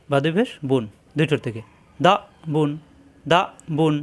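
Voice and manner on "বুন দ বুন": normal talking voice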